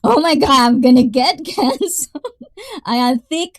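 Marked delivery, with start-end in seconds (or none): 0.0-2.2 laughing mock alarm, higher and louder. Real, not acted. Streamer joking to viewers about being canceled, laughing. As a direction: blurt it out through a laugh. Laughing at own risky joke.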